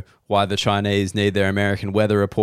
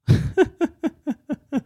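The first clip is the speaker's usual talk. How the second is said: high laugh. Real, not acted. Laughs at own line.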